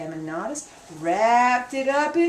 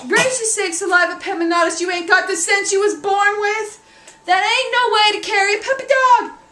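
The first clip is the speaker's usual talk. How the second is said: high, loud scolding; scolding mammy; 8 semitones higher, 6 dB louder than usual. Acted. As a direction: lay into the boy, exasperated.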